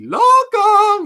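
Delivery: loud, very high mock shout; imitated movie yell. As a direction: belt it out high like a hammy film cry. Acting out a movie cry.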